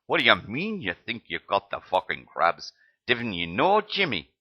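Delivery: incredulous medic, a bad Scottish accent climbing high; mock Scottish disbelief, hammed up; rubbish Scottish accent. Medic scoffs at Joey's doubt, bad Scottish accent. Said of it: "My Scottish accent is rubbish".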